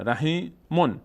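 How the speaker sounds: رحیمون split before a clear final "mun"; tanwin sounded. Shown as a demonstration. Teacher names the last word of the verse, tanwin sounded. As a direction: say رحیمون with its full "-un" ending.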